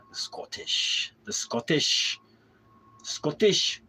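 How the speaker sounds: put-on trying out a Scottish accent, rising; practising exaggerated Scottish pronunciation; mock Scottish accent. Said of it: "to get the Scottish accent".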